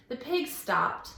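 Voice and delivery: plain reading voice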